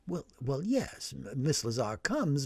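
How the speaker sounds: cautious priest. Acted. Priest calming an agitated man, soft and careful. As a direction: tread gently with an odd visitor.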